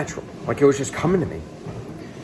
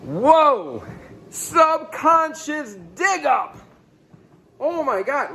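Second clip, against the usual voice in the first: awestruck exclamation; 14 semitones higher, much slower than usual. Real, not acted. Reacting to his revelation.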